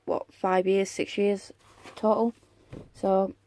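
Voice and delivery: usual speaking voice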